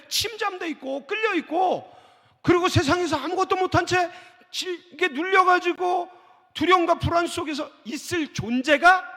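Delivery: loud, rising sermon climax; preaching shout. Real, not acted. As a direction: shout the point out to the congregation.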